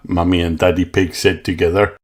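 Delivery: usual speaking voice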